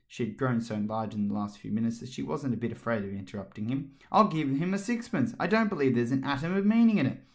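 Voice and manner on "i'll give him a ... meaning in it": defiant. A narrator's reading. Alice defiant to the court.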